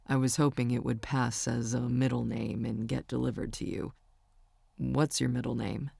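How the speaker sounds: a hint of an easygoing young man; Warren's voice. Narrator voices Warren on phone.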